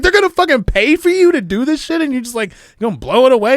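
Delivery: high, loud scolding mom; mom yelling. Retold or imitated. Mom scolding son for wasting a scholarship, loud high yell. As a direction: scold like an exasperated mother.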